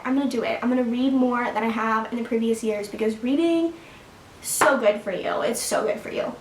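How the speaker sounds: normal talking voice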